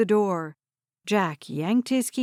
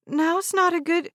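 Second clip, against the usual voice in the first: flustered call; 9 semitones higher than usual. Acted. Harper flustered behind door.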